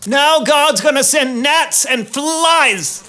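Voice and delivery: slow, high pronouncement; solemn warning. Acted. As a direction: warn of the plague slowly. Moses announcing plague.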